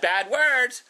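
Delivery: mock warning. Warning kids about swearing.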